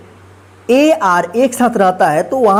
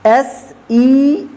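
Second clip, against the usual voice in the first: letters spelled one by one, very slow; spelling out letters; much slower than usual. Shown as a demonstration. Teacher spelling a word aloud slowly. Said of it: "इसको क्या पढ़ेंगे".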